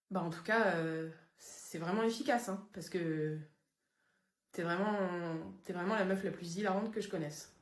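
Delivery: low, soft, slow flat sarcasm; deadpan sarcasm. Acted. Friend flatly mocking her humour, deadpan sarcasm. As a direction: say it dead flat, no joy.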